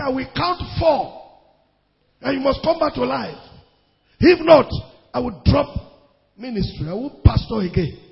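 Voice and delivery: loud ultimatum rising to a shout; commanding cry. Retold or imitated. Preacher retelling his loud ultimatum over the corpse. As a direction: command the dead to rise. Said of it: "this time not shouting".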